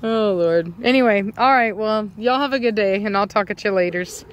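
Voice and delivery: normal talking voice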